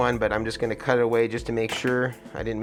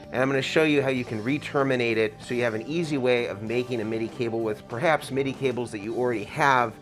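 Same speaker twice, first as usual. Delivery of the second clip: ordinary talk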